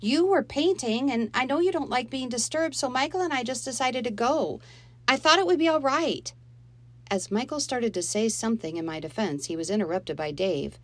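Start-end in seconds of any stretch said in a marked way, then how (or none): 0.0-6.3 put-on higher, louder girl pleading her case; defensive girl. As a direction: explain yourself to mother. Molly defending herself to Mom.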